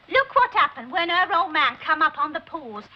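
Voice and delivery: usual speaking voice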